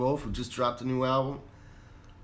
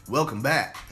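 loud, bright welcome like a morning DJ; radio host voice; 4 semitones higher, 7 dB louder than usual. Retold or imitated. Mocking radio dudes the way Chris D'Elia does, loud. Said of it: "Like Chris D'Elia".

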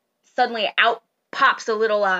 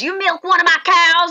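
higher, louder farmer voice; octopus farmer voice; 7 semitones higher, 7 dB louder than usual. Acted. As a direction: press the question like a suspicious farmer. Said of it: "he says".

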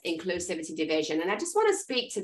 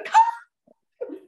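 a sharp, much higher and louder urgent cry; panicked recall call; 7 dB louder than usual. Shown as a demonstration. Trainer shows the emergency recall, shouted high in panic. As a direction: yell it as if the dog is about to be hit. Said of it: "you're going".

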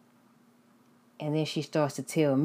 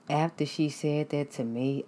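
ordinary delivery

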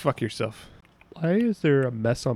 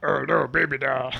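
Killer Croc voice; 4 dB quieter than usual. Acted. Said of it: "I don't think it was".

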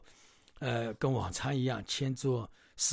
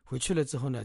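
plain reading voice

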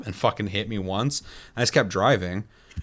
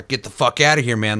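louder, higher mock tough-guy bark; mock gang threat; 4 semitones higher, 6 dB louder than usual. Retelling game gang members warning the player off, a loud mock bark. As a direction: play the street thug shooing him away.

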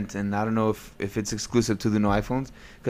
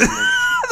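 squealing high laugh; 4 dB louder than usual. Real, not acted. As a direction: laugh up in falsetto. Laughing at the scene.

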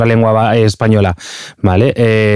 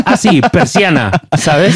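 slow, higher, laughing mock recall; laughing mock snob; 5 semitones higher, slower than usual. Friends mocking a snob: slow, higher, laughing mock recall. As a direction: play the snob who forgot his Spanish, amused. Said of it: "la tontería de decir".

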